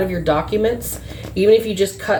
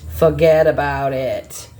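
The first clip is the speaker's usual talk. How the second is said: put-on Boston accent; 5 semitones lower than usual. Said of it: "Sounding like they're, like, from Boston".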